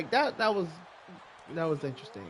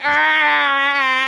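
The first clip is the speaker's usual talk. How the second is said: put-on loud pained old man yelp; old man fall cry; 8 semitones higher, 11 dB louder than usual. Comparing her yell to Kevin Hart's grandfather, pained yelp. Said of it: "sounded like Kevin Hart's grandfather".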